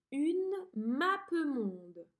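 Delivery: mappemonde drawn out slowly; slow dictation. Shown as a demonstration. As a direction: dictate Une mappemonde clearly. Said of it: "Appuie sur pause et écris ce mot". (mappemonde dicté lentement)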